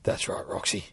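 mocking whisper; taunting whisper. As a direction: whisper the taunt through the bars. Prisoner taunts Roxy.

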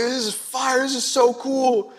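high, slow, giddy delight; overjoyed. Retold or imitated. Showing off the straw to the cops, high and giddy. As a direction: savor every word, beaming. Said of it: "I was so happy".

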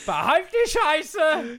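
high, slow, loud grumpy shout; stingy Swabian. Pretend. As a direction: bellow it like a cranky miser.

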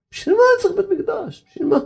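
high, sneering question; mock the doubter who asks what the Temple is for; a sneering scoffer's question. Mocking people who scorn the Temple.

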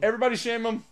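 1 mock rallying cry. Rallying crowd to shame.

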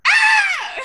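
sudden high, loud mock shriek; imitated background shriek breaking into laughter; Moira's scream. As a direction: let out one piercing comic cry. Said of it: "Moira just goes".

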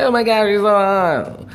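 high breathless swoon, mocking the fans; swooning fangirl. Mocking women who swoon over the actor, high gushing voice. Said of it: "And they're like".

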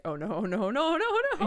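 high mock panic on the last no's; playful mock panic. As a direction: squeal the no's like a panicking girl. Panicked girl voiced in retelling.

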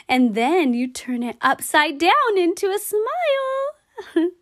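ordinary talk

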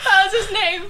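high-pitched squeal through laughter; real helpless laughter. Real, not acted. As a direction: barely get the words out, laughing. Cracks up over revealed name.

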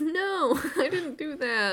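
put-on high, slow small-child denial; a little boy insisting it wasn't him; little Gaara denying. Little Gaara denies hurting the kids, high, slow and small.

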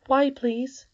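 put-on a child blurting out an answer; young Irene. A narrator's reading. Irene answering her grandmother, high child voice.

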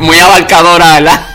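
high, loud words through laughter; laughing. Real, not acted. Laughs at own broad question.

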